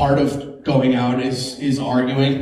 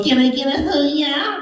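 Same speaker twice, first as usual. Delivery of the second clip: put-on prankster kid; 11 semitones higher than usual. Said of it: "he was gonna go".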